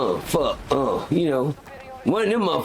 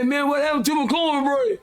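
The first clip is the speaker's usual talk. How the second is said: inmate complaining; 9 semitones higher than usual. Retold or imitated.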